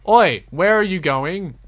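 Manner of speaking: loud, sharp call at the horse; yell. Real, not acted. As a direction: shout it after a runaway horse. Yelling at his horse.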